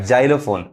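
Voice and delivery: जाइलोफोन starting with a 'j/z' sound; x read as j. Shown as a demonstration. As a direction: say जाइलोफोन as 'JAI-lo-fon'. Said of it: "जो पढ़ा रहा है". (जाइलोफोन को 'ज' से शुरू करें)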